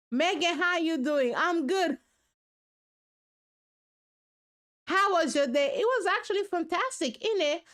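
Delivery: mock chat. Host mimics Megan's chat with a light British tag. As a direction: act out chatting with her, innit.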